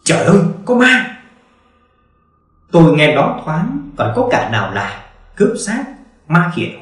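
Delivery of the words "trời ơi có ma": much higher, frantic yell of fright; panicked shout. Acted. Pallbearer fleeing the crying coffin, panicked shout. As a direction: scream it as if a ghost just spoke. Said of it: "cuống cuồng hét lên".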